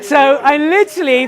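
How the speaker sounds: laughing through the words. Real, not acted. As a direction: chuckle it out. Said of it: "Did you do that as well?".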